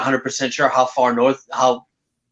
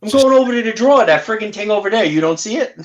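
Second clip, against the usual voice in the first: higher, fast Mainer voice; Maine accent impression; 8 semitones higher, faster than usual. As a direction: rattle it off like a Maine local. S0 imitates Chaos talking.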